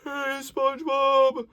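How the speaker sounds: high, slow, airy Hey, SpongeBob; breathy yawny call. Shown as a demonstration. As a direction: let air spill through the yawn. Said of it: "This one's a little more focused".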